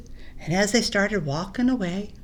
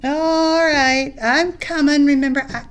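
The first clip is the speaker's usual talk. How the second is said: higher and louder answer; called reply; 4 semitones higher, 8 dB louder than usual. Acted. Ringu answering Batu, loud called reply. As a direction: call back you're on your way.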